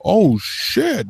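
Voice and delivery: the clerk is stunned; impressed surprise. Retold or imitated. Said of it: "He was like".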